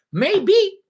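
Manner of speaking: a high questioning maybe, wondering aloud; doubtful maybe. Real, not acted.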